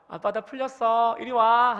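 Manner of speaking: a practiced, wholehearted welcome; truly forgiving. Shown as a demonstration. Father after practicing in the bathroom, warmly calling his child. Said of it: "화장실에 들어가서 엄청 연습했어요".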